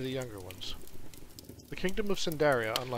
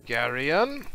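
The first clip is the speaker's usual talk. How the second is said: a louder, sharp snap of his name; sharp warning call; 8 dB louder than usual. Acted. Aunt silencing the boy at bedtime, a sharp warning call. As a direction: cut him off with his name. Aunt cuts off boy's questions.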